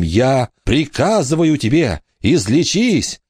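high, desperate order to heal; trembling command. A narrator's reading. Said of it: "произнёс он трясущимся голосом".

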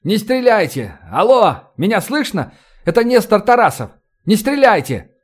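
high, urgent calls; calling out. A narrator's reading. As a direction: hail armed men from cover. Calling to armed men not to shoot.

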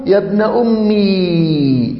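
يا ابن أمي drawn out slowly, with the ي of أمي held long; slow demo pronunciation. Shown as a demonstration. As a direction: stretch يا ابن أمي as a slow model for students. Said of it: "تقول". (نطق يا ابن أمي ببطء مع إطالة الياء)

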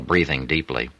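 ordinary delivery

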